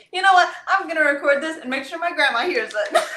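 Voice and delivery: retold burst of defiant decision; bold resolve. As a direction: announce it boldly with a laugh at the end. Quotes her own bold resolve.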